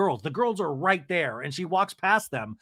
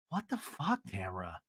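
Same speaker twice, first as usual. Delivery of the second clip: normal talking voice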